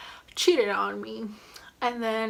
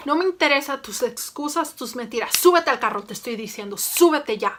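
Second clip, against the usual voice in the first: angry dad in Spanish; 3 semitones higher, 5 dB louder, faster than usual. Retold or imitated. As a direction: order her into the car furiously. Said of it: "let me do a recreation".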